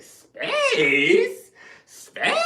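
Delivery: shrieking high disbelief; loud stunned echo breaking into a laugh; incredulous. Retold or imitated. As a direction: screech it back in disbelief, laughing. Incredulous retort to girlfriend.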